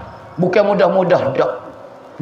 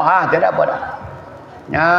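ordinary talk

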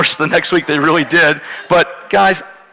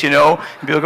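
usual speaking voice

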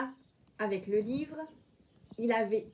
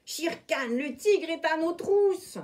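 higher, louder alarmed warning; urgent warning; 5 semitones higher, 5 dB louder than usual. A narrator's reading. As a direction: warn them the tiger is coming. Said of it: "lui cria la panthère".

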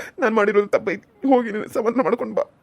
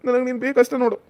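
normal talking voice

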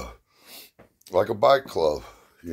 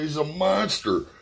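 imitating his son; 10 semitones higher than usual. Son telling dad about the visitor, mock awed and higher. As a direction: drag it out, mildly amazed. Son's awed retold remark.

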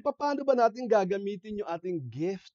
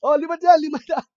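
loud high basketball shout; shouting on the court; 12 semitones higher, 17 dB louder, faster than usual. Retold or imitated. Boy yelling for players at the court, loud and high. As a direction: yell for your team of five. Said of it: "sisigaw ka".

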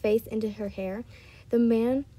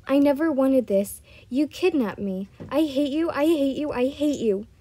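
loud, building angry accusation; frustrated outburst; 3 semitones higher, 6 dB louder than usual. A narrator's reading. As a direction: let it all burst out, the repeats tumbling together. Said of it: "let out her frustrations".

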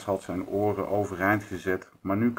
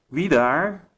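high, loud call through the door; 9 semitones higher, 6 dB louder than usual. A narrator's reading. Hare calls out to the knocker at night, loud and high. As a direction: shout to the one knocking. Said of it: "riep hij".

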